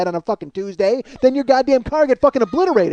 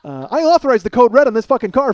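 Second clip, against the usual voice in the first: ordinary talk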